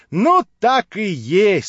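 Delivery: a wife catching her husband out, exclaiming. Acted. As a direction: throw up your hands and cry it out. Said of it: "вскричала она".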